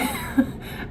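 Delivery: breathy silent laugh; quick laugh under the breath; unvoiced laugh. Real, not acted. Laughs at own joke.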